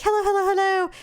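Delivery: high chirpy puppy greeting; eager puppy voice. Retold or imitated. Puppy greeting the big dog on his bed, high and eager.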